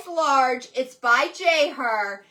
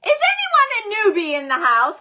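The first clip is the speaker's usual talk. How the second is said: higher, louder hype call to the room; 7 semitones higher, 6 dB louder, faster than usual. Real, not acted. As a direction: shout it out to the newcomers. Calling out to new viewers.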